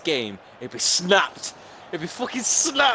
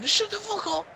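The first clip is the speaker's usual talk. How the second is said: mother shouting; 14 semitones higher, 3 dB quieter than usual. Retold or imitated. Gamer predicts mother complaining about noise, mock shouting. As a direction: imitate a shouting mother in a raised pitch. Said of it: "My mother's gonna come up shouting".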